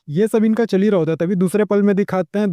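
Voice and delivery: usual speaking voice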